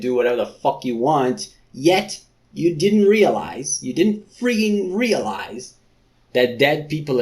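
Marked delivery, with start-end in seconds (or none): 1.7-5.8 slow, loud, drawn-out scolding; exasperated rant. Real, not acted. Reader scolding Rinda, slow exasperated rant. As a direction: spell out her stupidity slowly. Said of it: "exasperated rant".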